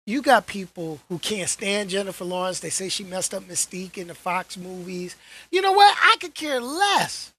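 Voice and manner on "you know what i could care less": loud and high; dismissive. Real, not acted. Vlogger brushing off Lawrence haters, dismissive. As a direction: wave off the critics. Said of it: "dismissing critics".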